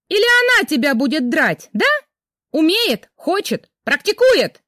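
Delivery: high, loud mocking rage; wife sarcastically screaming at husband; sarcastic screaming. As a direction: scream the taunts at him. Jealous wife raging sarcastically.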